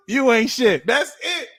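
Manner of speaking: laughing retort. Shown as a demonstration. Vlogger repeating his comeback, laughing. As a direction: cackle it at them. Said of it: "be like".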